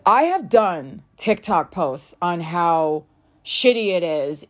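usual speaking voice

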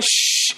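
whispered hiss of smoke. Retold or imitated. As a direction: imitate smoke hissing out. The smoke's going.

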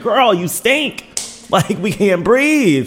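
high, slow sassy disgust; mock disgusted. Voicing a disgusted courtesan's attendant.

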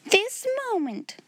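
very high, slow, emphatic order; fierce answer. A narrator's reading. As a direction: demand instant obedience. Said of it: "was the fierce answer".

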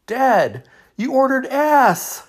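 put-on high, loud girl's cry of glee; daughter laughing. Daughter laughs at dad's dish, high shrieking delight. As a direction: shriek it like a delighted teen girl. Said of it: "broke out in laughter".

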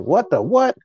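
high, loud baffled outburst; sudden high disbelief; bewildered. Retold or imitated. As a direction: blurt it like the meaning just hit you. Stunned by what he reads.

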